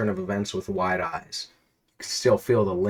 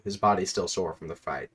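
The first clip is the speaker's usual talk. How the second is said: ordinary talk, nothing marked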